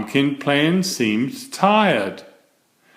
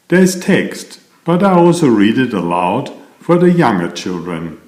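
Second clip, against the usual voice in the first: louder, brisk promo voice for parents; ad read; 4 dB louder than usual. Acted. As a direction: sell the series with upbeat energy. Promo read after the story.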